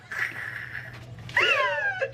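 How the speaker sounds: very high squealing laugh; high, gleeful shriek; delighted squeal. Real, not acted. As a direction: squeal with joy. Thrilled at signed books.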